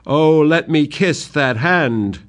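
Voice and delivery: put-on old servant crying out to his king; loyal cry. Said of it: "loyally cries out".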